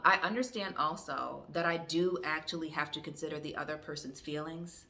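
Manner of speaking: normal talking voice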